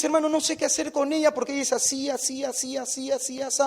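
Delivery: rattled-off complaints. Retold or imitated. Boyfriend criticising his girlfriend to the preacher, mock rattle. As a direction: imitate a nagging list, running on and on.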